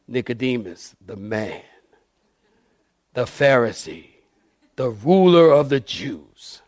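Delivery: movie trailer voice. Shown as a demonstration. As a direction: announce it like an epic film trailer. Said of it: "Sounds like a movie trailer".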